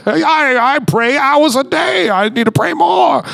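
high-pitched pious parody; hammed-up protest in a put-on voice; indignant religious spirit. Religious spirit defending hours of prayer, high mock indignation. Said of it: "the religious spirit just being like".

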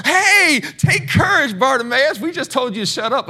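high, loud fake-friendly urging; sudden phony encouragement; mock fickle crowd. Preacher mocks crowd now cheering on the beggar.

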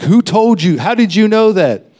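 loud, high, hammering on who; pressing him hard for answers; angry demand. Retold or imitated. Coworker angrily demands how he knew, high loud. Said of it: "He got angry".

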